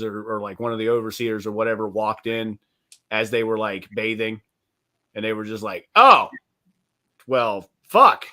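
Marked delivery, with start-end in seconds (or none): 5.9-8.3 mock startled curse. Overseer walks in on bathing slave, startled curse. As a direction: caught off guard mid-bath.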